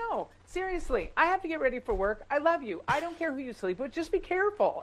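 a hint of a no-nonsense mother; mom's voice. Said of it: "she goes".

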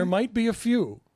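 mocking echo.

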